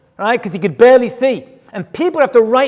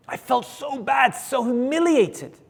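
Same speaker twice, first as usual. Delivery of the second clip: hushed sorrow; 10 dB quieter, slower than usual. Retold or imitated. Recalling humiliation.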